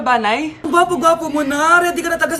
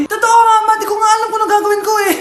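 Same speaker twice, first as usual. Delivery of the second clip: higher, louder and fast, pleading with mom; panicked pleading; 6 semitones higher, 3 dB louder, faster than usual. Acted. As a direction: rush it out like a panicked kid. Son panicking about crying baby.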